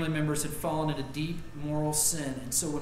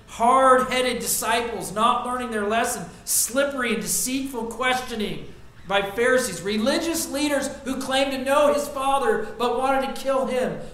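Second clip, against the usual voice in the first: higher, louder preaching that swells through the list; building preaching; 6 semitones higher, 6 dB louder than usual. Acted. Preacher to the congregation listing Jesus' trials, rising fervor. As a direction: pile up the sufferings with rising force.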